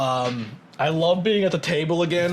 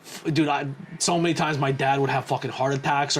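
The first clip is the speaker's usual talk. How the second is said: ordinary talk, nothing marked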